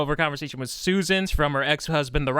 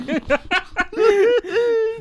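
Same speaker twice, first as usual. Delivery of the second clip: shrieking laugh; high cackling laugh; 13 semitones higher than usual. Real, not acted. Big laugh.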